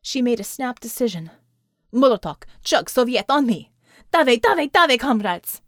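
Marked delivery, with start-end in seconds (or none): 1.9-5.6 loud, urgent commander's call; rallying battle shout. A narrator's reading. Commander rallies comrades to charge the Thulians, loud rallying shout.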